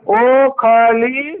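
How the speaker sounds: very slow, split in two parts; sounding out. Shown as a demonstration. Teacher sounds out syllables for the child. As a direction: pull the syllables apart. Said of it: "पहले इनको जोड़ना है".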